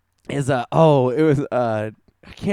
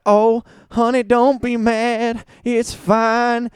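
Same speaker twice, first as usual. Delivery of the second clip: higher, slow put-on Southern drawl; Southern woman accent; 8 semitones higher, 3 dB louder, much slower than usual. Retelling the show, the wife soothing her husband in a Southern accent. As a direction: coo it in a hammed-up Southern accent.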